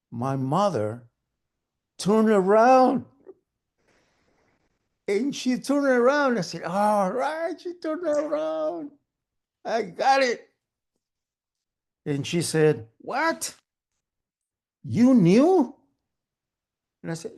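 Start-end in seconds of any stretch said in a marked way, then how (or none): 2.0-10.5 triumphant. Acted. Son finally made mom turn around, triumphant. 13.0-15.7 high, incredulous outburst; shocked disbelief. Acted. Mother learning her son knew, shocked.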